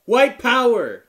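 high, loud drawn-out mock yell; imitated heckler yell. Comic imitating a woman heckling mid-joke, yelling out. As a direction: holler it like a heckler in the crowd. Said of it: "this fucking chick yells out".

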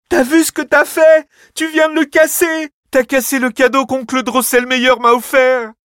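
shrieking, very high and loud anger; furious tantrum. Acted. Clara rages at Fritz for breaking her gift, shrieking. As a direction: rage at your little brother. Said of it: "pique une colère noire".

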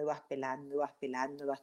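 fading repetition. Shown as a demonstration. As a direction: let the repeated words fade away to almost nothing.

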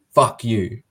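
imitating a deadly earnest curse; very serious insult. Rival fan pointing at the speaker, very serious. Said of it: "very seriously".

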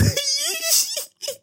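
high squealing laugh; can't hold the laugh in; helpless high laugh; burst of laughter. Real, not acted. Reacting to funny chat.

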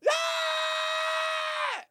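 high piercing imitated shriek; mock metal scream. Imitating the woman screaming in his ear, high shriek. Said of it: "going like".

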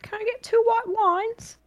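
high, slowed polite order; acted friend ordering. Friend asking the bartender for wine, high acted order. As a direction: voice a friend's request, pitched well up.

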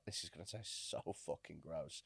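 inner thought. Retold or imitated. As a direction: say it under your breath. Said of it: "in my head, I was like".

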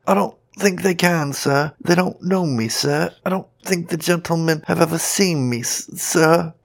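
high, anxious pleading; horror-struck. A narrator's reading. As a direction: plead respectfully in fear, faltering at the end. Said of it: "replied the horror-struck Saxton".

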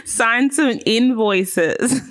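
slow words broken by laughter; laughing. Real, not acted. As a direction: drag it out while cracking up. Laughing at her own joke.

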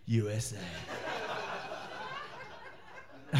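low, soft imitation of a proud mutter; proud under-breath murmur. Proud American next to someone from Massachusetts, under his breath. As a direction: say it quietly to yourself, proudly. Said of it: "He's just so proud".

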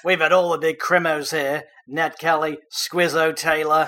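put-on light Australian accent, "crimos" said the Aussie way; hammed-up Aussie tour guide translating. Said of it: "Perhaps you can translate afterwards".

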